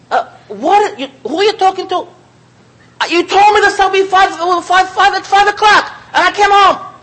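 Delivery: high, loud mock yelling at the wife; hammed-up husband yelling, supper not ready; mock angry husband. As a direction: play the husband shouting about a late supper. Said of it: "her husband screaming at her".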